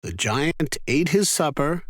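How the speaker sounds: ordinary delivery, nothing marked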